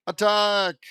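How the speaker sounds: a villain yelling her troops forward; shouted command. Acted. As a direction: bark the order to charge.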